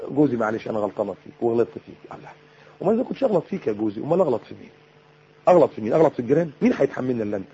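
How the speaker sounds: soft, low, coaxing apology; sweet-talking. Acted. Wife sweet-talking her husband before discussing things, soft and coaxing. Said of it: "ما تتكلمي معاه كلام حلو".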